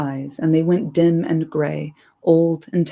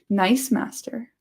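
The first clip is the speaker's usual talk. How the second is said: soft; 5 semitones higher, slower than usual. A narrator's reading. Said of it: "said Gollum softly".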